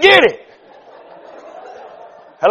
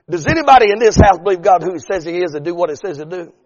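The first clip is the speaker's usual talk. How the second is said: ordinary talk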